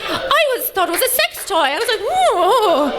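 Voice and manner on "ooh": high, drawn-out ooh of interest; mock intrigue. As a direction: play up being naughtily intrigued. Mimics intrigued reaction to a shoehorn.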